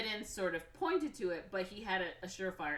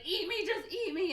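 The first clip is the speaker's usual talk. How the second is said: high, louder frantic begging; acted desperate plea; 13 semitones higher, 5 dB louder than usual. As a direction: beg wildly as the character. Mickey begs creepers to eat him.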